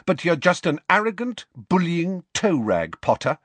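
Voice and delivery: high-pitched, cold scolding; cold contempt. A narrator's reading. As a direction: coldly tell off a bully. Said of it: "she said coldly".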